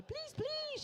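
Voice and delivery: very high begging please please; pleading wife. Retold or imitated. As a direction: plead again, high and drawn out. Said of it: "she's saying".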